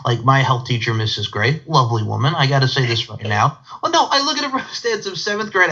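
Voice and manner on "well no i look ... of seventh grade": high-pitched, on the edge of laughter; amused squeal. Real, not acted. Host correcting himself on his health class story, high and amused. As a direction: push the words out high through amusement.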